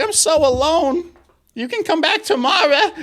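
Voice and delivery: put-on lonely old lady. Lonely old lady to window cleaner, high laughing granny voice. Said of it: "she's like".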